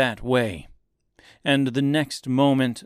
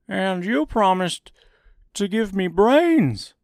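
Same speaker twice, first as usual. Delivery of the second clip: higher, louder strawman voice; Scarecrow voice; 10 semitones higher, 5 dB louder than usual. A narrator's reading. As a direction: voice the floppy Scarecrow demanding. Scarecrow's voice in the story.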